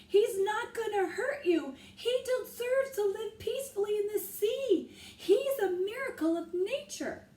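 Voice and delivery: loud, high, near tears; shouted plea. A narrator's reading. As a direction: plead with all your voice. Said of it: "he shouted".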